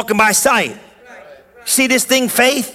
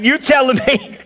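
ordinary talk

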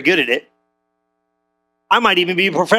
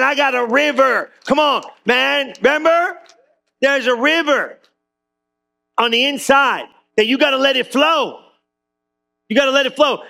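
rising preaching fervor; 5 semitones higher than usual. Real, not acted. Preaching builds to climax.

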